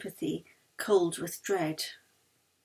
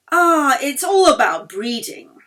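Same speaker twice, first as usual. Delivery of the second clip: louder, higher gush; enthusing; 4 semitones higher, 9 dB louder than usual. A narrator's reading. Lady Chiltington gushes to Yeli about breeding. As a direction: exclaim it with relish. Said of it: "Lady Chiltington enthused".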